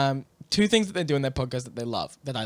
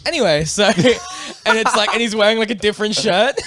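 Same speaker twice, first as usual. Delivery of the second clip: laughing; 5 semitones higher, 6 dB louder than usual. Real, not acted. Laughing retelling the punchline.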